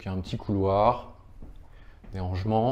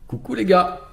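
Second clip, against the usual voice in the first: calling out; 8 semitones higher, 5 dB louder than usual. Real, not acted. Greeting colleagues on video call.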